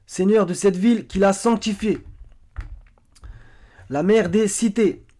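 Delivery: loud, high, declaimed quotation; emphatic verse reading. Acted. Presenter quoting verses on the cités, emphatic declaiming. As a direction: proclaim each phrase with force.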